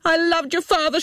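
high-pitched cry at no extra volume; an anguished confession in a high, contained scream; mock scream. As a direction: scream it without real loudness. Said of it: "she screamed".

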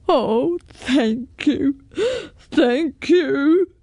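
overcome, weepy dragon voice; sobbing dragon. A narrator's reading. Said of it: "he sobbed all the louder".

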